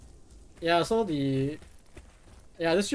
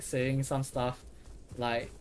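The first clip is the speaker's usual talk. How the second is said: usual speaking voice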